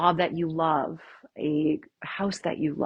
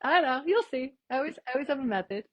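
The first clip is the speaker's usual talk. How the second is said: high, teasing voice; light mock of the listeners; audience voice; 6 semitones higher than usual. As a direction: voice the puzzled audience, playfully. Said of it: "You guys are like".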